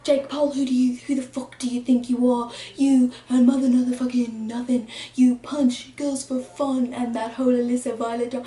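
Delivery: put-on British diss-track delivery. Vlogger recreating a diss track, mock British accent. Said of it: "I'll recreate it".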